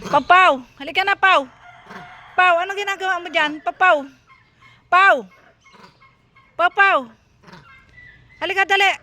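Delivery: loud, high, insistent calls; calling out. Real, not acted. Vlogger urging Paulo to come quickly, calling out. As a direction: call out to summon from a distance.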